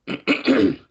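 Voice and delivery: choking on the words; choking mid-sentence. Real, not acted. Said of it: "I'm choking".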